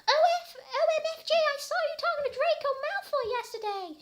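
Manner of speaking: put-on very high, loud, fast girly squeal; excited squeal. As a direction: gush it out like a thrilled teen. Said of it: "she said excitedly".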